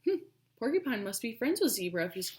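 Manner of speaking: plain reading voice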